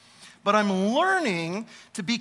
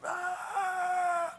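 high strained yelp of frustration; frustrated cry; 11 semitones higher, 6 dB quieter than usual. Retold or imitated. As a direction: let out a sharp exasperated cry. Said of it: "I call him up and I'm".

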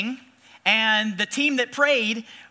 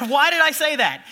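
rueful laugh; 6 semitones higher, 4 dB louder than usual. Real, not acted. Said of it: "laughs at himself".

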